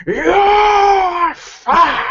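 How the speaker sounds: long high yell, then exclamation; exasperated outburst. Acted. Caller who can't stand it anymore, exasperated yell. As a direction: cry out loud, drawing out the first word.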